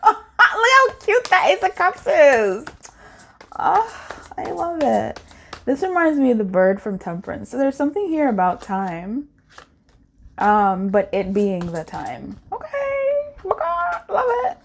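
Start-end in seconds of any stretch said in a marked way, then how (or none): 0.0-5.2 high, slow, cooing delight; gushing. Real, not acted. Reader cooing at a cute tarot card, high and delighted. 12.5-14.6 very high excited squeal. Real, not acted. Reader squealing at a card she loves.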